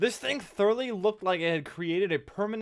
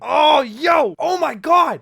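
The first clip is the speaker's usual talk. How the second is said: loud high yell of excitement; excited shout; 6 semitones higher, 12 dB louder, slower than usual. Real, not acted. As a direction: blurt it out at full volume. Sudden outburst during gameplay.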